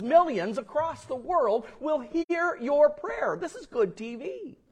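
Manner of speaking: high, indignant reporter's protest; acted-out flustered indignation; upset. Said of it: "he got a little upset".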